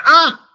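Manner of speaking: sharp high yelp of pain from a stubbed toe; high mock shout of pain. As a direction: cry out as if you hit your leg. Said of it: "he shouts".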